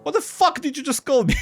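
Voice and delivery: high-pitched indignant outburst; outraged. Acted. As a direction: squeal it in outrage at an insult. Character outraged at being insulted.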